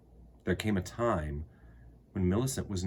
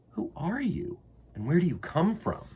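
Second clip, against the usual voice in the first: put-on curious boy; 8 semitones higher than usual. Red-haired boy questions stranger.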